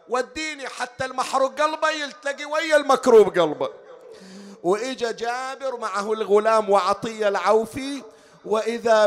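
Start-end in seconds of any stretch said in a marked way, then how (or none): 0.1-3.7 high-pitched pleading lament; grieving plea. Acted. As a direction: plead high and mournfully to be led. Jaber pleads to be led.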